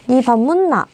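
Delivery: Gyeongsang dialect 니 밥 묻나, a rising question. Shown as a demonstration. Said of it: "경상도 사투리".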